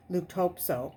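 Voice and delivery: plain reading voice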